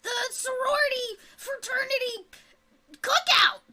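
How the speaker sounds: high, loud rushed goblin excuse; high flustered goblin voice; flustered hobgoblin. Acted. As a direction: stammer out the excuse. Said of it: "she goes".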